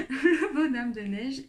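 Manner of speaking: words bubbling out of a giggle; laughing. Real, not acted. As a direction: start the phrase still giggling. Still laughing at her accent.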